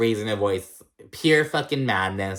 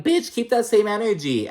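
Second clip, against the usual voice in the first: high, louder, sassy clapback; sassy retort; 11 semitones higher, 4 dB louder than usual. Retold or imitated. Mocking her classmates, a high sassy clapback. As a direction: throw shade at the fake-sorry kids. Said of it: "And I'm like".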